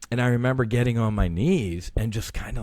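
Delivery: ordinary talk, nothing marked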